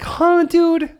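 a high, loud baffled huh. Retold or imitated. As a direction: react with loud disbelief. Baffled reaction to fillet.

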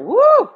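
high, loud whoop of admiration; excited woo. Real, not acted. Cheering a favourite red carpet look, excited whoop. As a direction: let out a big high "woo".

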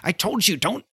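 high, fussy lab tech scolding; complaining technician. Retold or imitated. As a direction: whine it like an uptight lab technician. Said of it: "and he's complaining".

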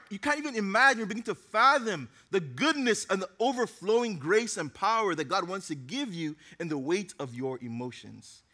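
normal talking voice

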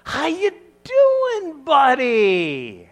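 booming overfriendly hello; cheerful greeting. Acted. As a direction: hail an old buddy across a field. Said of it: "cheerfully says".

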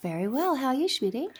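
soft, leaning-in hush; close-mic sexy voice. Acted. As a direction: purr it into the mic. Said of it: "you leant into the microphone".

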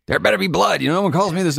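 a high, comic imitation of a man woken at midnight; imitating grumpy Dennis.

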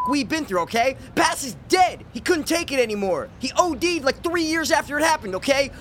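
[name]. Acted. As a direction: rant high-pitched with no remorse. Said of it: "the surviving member of the now defunct Two Dopeless Hope Fiends podcast, [name]".

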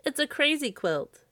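high, small scarecrow voice. A narrator's reading. Scarecrow answers Santa from the dark, high voice.

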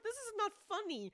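high, loud mock outrage; indignant scolding. Podcaster scolding the agent for laughing, indignant. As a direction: tell off a giggler, voice shooting up.

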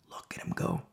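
very low, hushed admiration; low hushed murmur, almost under the breath; hushed aside. Real, not acted. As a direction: drop right down to a near whisper. Hushed, then loud repeat of line.

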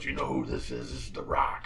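deep, low menacing Rock voice; deep mock-menacing phone threat; The Rock impression. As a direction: drop the voice low like a tough wrestler. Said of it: "doing a little rock impression".

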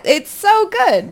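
high, slow, swooning praise; gushing delight. Real, not acted. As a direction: melt over how good it is. Gushing about the dance.

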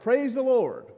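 loud, high joyful shout; shouted praise. Retold or imitated. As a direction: call it out across the yard. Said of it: "she would shout".

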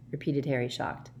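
ordinary delivery

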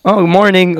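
bright mock American "morning"; imitating American housemates. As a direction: sound like an eager roommate waking up. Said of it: "I can hear the accent is like".

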